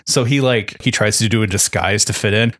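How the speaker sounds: normal talking voice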